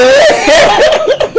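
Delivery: high squealing laugh; shrieking laugh; burst of laughter. Real, not acted. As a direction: crack up at your own joke. Cracks up at own quote.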